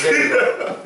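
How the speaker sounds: breathy laugh of praise. Real, not acted. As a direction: laugh it out, pleased. Praising a good kick.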